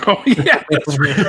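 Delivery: normal talking voice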